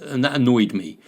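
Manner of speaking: ordinary delivery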